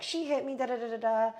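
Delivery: high-pitched whiny accusation; mock yelling. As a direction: imitate someone yelling a complaint, trailing into filler. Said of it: "She's yelling, like, about me".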